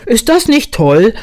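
high, loud, delighted bear; calling down. A narrator's reading. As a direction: call it down proudly from above. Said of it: "rief der Winnie von dort oben zu". (stolz von oben herabrufen)

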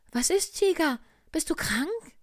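a kindly little bear voice, high and loud. Acted. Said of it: "rief der kleine Tiger".

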